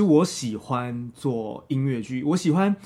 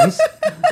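high squeal of laughter; 4 dB louder than usual. Real, not acted. Guest laughs mid-story.

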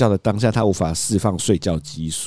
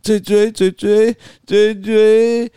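put-on high, slow toddler whine; imitating toddler; 13 semitones higher, 5 dB louder, much slower than usual. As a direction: play the crying little boy. 父亲模仿孩子哭喊奶嘴. (模仿小孩拉长哭喊)

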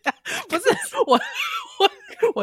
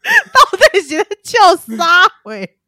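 laughing; 7 semitones higher, 7 dB louder than usual. Real, not acted. Laughing mock scolding.

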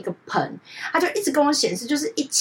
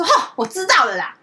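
hot-tempered retort; 7 semitones higher, 4 dB louder than usual. Retold or imitated. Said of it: "我那个姐是个小暴脾气".

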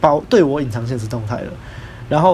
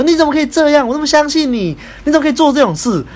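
high, loud furious outburst, mimicking the friend; angry scolding; 13 semitones higher, 6 dB louder than usual. Friend A scolding me on the phone for betraying trust, high loud angry shout. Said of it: "很气愤的骂我说".

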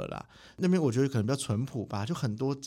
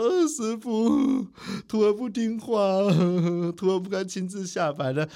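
possessed disciple; 6 semitones higher, slower than usual. Retold or imitated. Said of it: "我说说".

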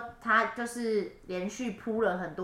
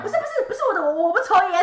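laughing; 11 semitones higher, 8 dB louder, faster than usual. Retold or imitated. Panicked denial, laughing.

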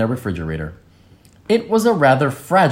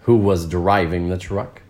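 plain reading voice

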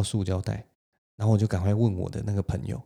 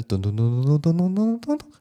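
score-counter sound; 8 semitones higher, 4 dB louder than usual. Retold or imitated. Game show score counting up.

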